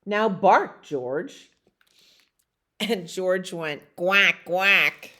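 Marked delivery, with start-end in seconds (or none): 3.9-5.1 quacking. Acted. George the dog quacks.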